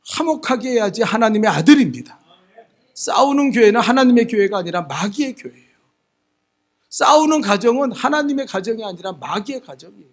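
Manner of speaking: loud, hammering sermon declaration; forceful preaching. Real, not acted. Preacher warning the church about quarrelling, loud forceful preaching. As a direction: drive each phrase home loudly.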